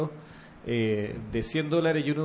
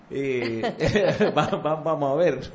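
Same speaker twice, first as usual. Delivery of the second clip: normal talking voice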